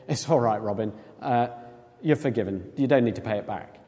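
low, soft, forgiving tone; gentle reassurance. Acted. Kate lets Robin off the debt, gently reassuring. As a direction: let them off quietly and kindly.

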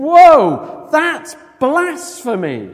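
loud high "whoa", then drawn-out accusation; outraged shock. Acted. Teachers of the law hear Jesus forgive sins, shocked. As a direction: recoil aloud, scandalised. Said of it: "all the teachers of the law were sitting there thinking".

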